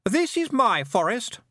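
put-on high, slow, haughty boy's voice; bossy young lord, drawn out; imperious boy. A narrator's reading. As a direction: declare ownership in a high, lordly voice. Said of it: "said the boy".

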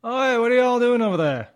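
loud, high holler across a field; calling out. Acted. Farmer hailing loitering travelers, calling out. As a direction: holler over at strangers. Game master's farmer calls out to players.

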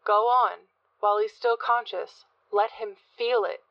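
usual speaking voice

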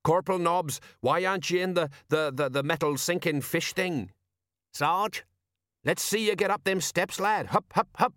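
high-pitched parade-ground hectoring; sergeant's shout. A narrator's reading. Flustered sergeant orders corporal into the boat, mild shout. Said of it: "and shouted".

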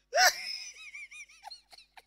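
high, loud shriek of laughter; long high cackling fit; uproarious laugh. Acted. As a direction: explode laughing and let it run on. Laughs again at the complaint.

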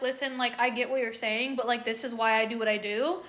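calm, reasonable retelling of a kind reply; said so nicely. Retold or imitated. Creator replies nicely to a critical commenter, gentle and calm. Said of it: "I said it so nicely".

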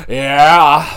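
loud drawn-out hype yell; catchphrase shout. Retold or imitated. Fan echoes a wrestler's catchphrase after announcing the match, catchphrase shout.

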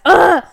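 loud, high exasperated groan; sharp burst of frustration; exasperated ugh. Real, not acted. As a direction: groan loudly at your own slip. Frustrated at own repeated slip.

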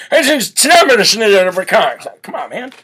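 slurred mumbling Biden, fading to a dropped mutter; Joe Biden impression. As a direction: mush the snaps together. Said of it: "Joe Biden ... speaks in cursive".

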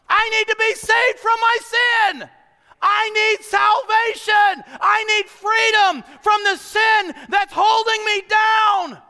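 loud, high, slow preaching cry; preaching at full force. Acted. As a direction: preach it out, pleading for salvation.

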